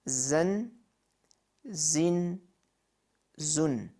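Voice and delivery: "zun", "zin", "zun" as separate, clear syllables; Arabic letter sounds. Shown as a demonstration. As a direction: spell out "zun", "zin", "zun" slowly for learners. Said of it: "Letter twelve".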